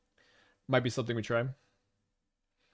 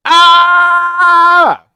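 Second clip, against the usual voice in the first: high piercing startled yell; surprised shriek; 19 dB louder than usual. Real, not acted. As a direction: shriek out in shock. Sudden shriek at game play.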